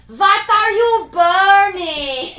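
very slow, high, sing-song holler; exaggerated mock shout. Repeating for someone who can't hear, huge drawn-out mock shout. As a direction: bellow each word slowly, hammed up. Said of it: "she can't hear you".

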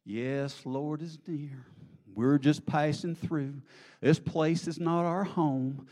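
drawn-out, rising revival cry; Bible Belt believer. Acted. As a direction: stretch it out like an old-time church testimony. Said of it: "the middle of the Bible Belt".

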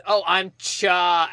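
slowly stretched, unfinished Cha; imitating someone taking way too long; drawn-out stall. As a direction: stall on the name, holding the vowel. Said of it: "takes way too long".

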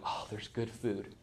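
low, soft voice of a poor woman finding food; soft hushed delight. Retold or imitated. Preacher voicing Ruth finding grain, soft hushed delight. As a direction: breathe it like a quiet discovery.

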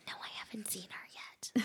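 unvoiced secretive whisper. A kid hiding his talk from his mother, whispering. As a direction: hush it so mom can't hear. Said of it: "but then he whispers".

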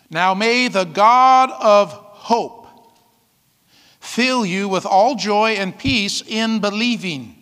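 slow, solemn reading of the verse; scripture reading. Real, not acted. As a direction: read the blessing slowly and gravely. Reads scripture aloud.

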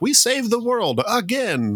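a mock Brit crowing, in a poor British accent; bad British accent. Podcast host mocks British pride in a bad British accent. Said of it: "It's not a very good British accent".

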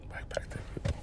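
unvoiced whispered aside. Real, not acted. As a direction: breathe it as a secret.

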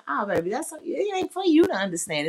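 ordinary talk

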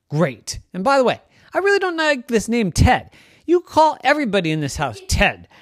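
high, loud insistent cat voice; the cat stakes its claim; demanding cat. Acted. Cat Ted demands his own name from Salim, insistent cat.

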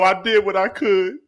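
mock crying. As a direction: fake a man crying in the car. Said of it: "when you cry in the car".

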